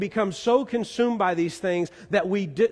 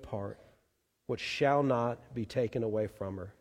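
soft, gentle, lower reply to Martha; gracious and loving; 5 semitones lower, 5 dB quieter than usual. Acted. Jesus gently answering frustrated Martha, soft and loving. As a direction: answer her tenderly, without scolding. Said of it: "the most gracious and loving way".

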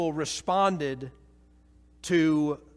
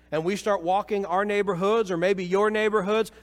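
fervent preacher pushing louder; rising preaching; 5 semitones higher, 6 dB louder than usual. Acted. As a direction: build the call with urgency. Preacher builds to full force.

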